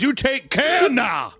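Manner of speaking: slow high drawled farewell; teasing imitation of a Southern local; mock Southern sendoff. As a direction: drawl "take care now" sweetly. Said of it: "That's what you sound like".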